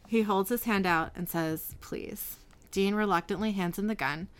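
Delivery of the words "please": barely voiced, whispered begging; soft, breath-only entreaty; hushed plea. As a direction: plead under your breath. Sam's tearful plea, read aloud.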